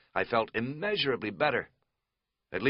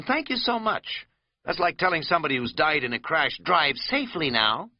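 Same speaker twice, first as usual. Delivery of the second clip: sarcastic; 7 semitones higher, 3 dB louder than usual. Acted. Katz sarcastic reply.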